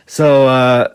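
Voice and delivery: normal talking voice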